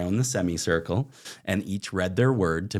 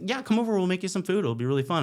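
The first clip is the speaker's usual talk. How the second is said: much higher, sing-song welcome; hammed-up eager host invitation; cheery hosts; 11 semitones higher, faster than usual. Narrator imitating hosts inviting him over, high and sing-song.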